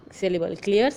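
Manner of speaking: ordinary talk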